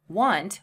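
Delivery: want said slowly and clearly, one syllable; one-syllable want, carefully spoken; clear model word. Shown as a demonstration. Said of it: "which is the base verb".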